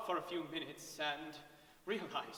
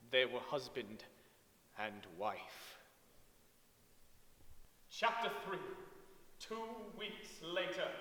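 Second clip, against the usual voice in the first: plain reading voice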